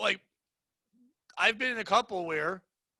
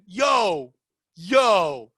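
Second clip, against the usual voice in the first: loud, drawn-out excited call; excited recognition; 9 dB louder than usual. Retold or imitated. Stranger hailing a Seth Rogen soundalike, excited. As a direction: call out like you've spotted a lookalike. Acting out people recognizing his voice.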